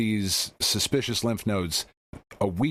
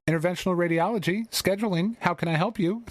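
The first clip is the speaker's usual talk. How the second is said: high, quick switchboard greeting; imitated scheduling receptionist, brisk and high; receptionist answering the phone; 10 semitones higher, faster than usual. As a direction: rattle off a high-pitched office phone greeting. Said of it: "lady picks up".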